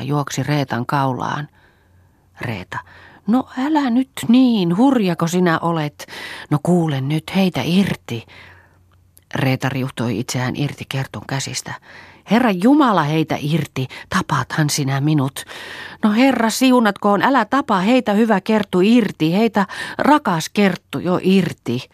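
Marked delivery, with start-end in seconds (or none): 3.3-8.3 higher voice, mildly panicked; worried scolding, pitched up; alarmed protest. A narrator's reading. Reeta struggles in Kerttu's embrace. 12.3-21.9 loud, high cries for release; alarmed outburst, swooping high; panicked pleading. A narrator's reading. Reeta begs to be released.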